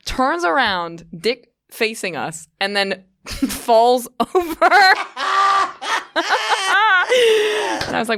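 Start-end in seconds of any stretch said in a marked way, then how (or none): 4.7-7.8 high, loud helpless cackling laughter; loud laughing fit. Real, not acted. Recalling the peeing man falling over, loud laughter. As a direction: laugh until it almost turns to crying.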